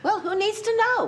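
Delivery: loud defensive comeback; sharp retort. Acted. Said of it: "have to yell at me".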